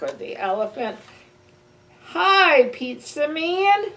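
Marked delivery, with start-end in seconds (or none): 2.1-3.9 higher, louder, slow and delighted; happy greeting. Acted. Child finally greeting the pizza man, loud and happy. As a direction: greet him with joy.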